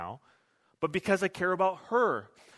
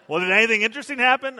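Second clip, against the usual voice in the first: eager wife; 6 semitones higher, 7 dB louder, faster than usual. Retold or imitated. Wife pressing husband for details.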